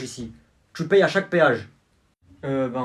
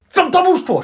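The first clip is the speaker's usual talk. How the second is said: much higher, loud angry yell; furious yell; 12 semitones higher, 10 dB louder than usual. Acted. Dad yelling at the lawyer to shut up. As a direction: bark him into silence.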